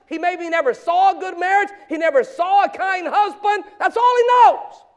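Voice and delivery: loud, high-pitched sermon exhortation; full-force preaching. Real, not acted. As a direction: drive it home to the congregation, building to a shout. Preaches forcefully at young people.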